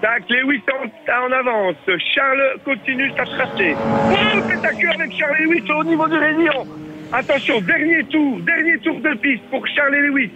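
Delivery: excited sports commentator calling a toy race; race commentary. Acted. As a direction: call the race as it happens. Live race play-by-play.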